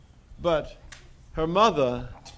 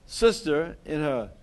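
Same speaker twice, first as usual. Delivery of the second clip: ordinary delivery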